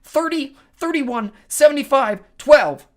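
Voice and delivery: high, loud quarterback calling a play; belted out. A narrator's reading. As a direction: belt out each number separately. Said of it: "belted it out like a quarterback calling a play".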